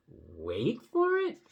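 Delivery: slow, stretched "wait" with "for it" jumping up; emphasized word. Shown as a demonstration. Showing the italic word, drawn-out emphasis on "wait". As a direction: lean long on "wait", end on a rising question. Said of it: "Mo wanted us to emphasize that word".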